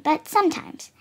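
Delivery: usual speaking voice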